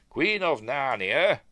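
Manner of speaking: loud, slow royal title; declaimed like a royal decree; proclamation. Acted. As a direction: announce it with mock grandeur. Reading out an official warrant.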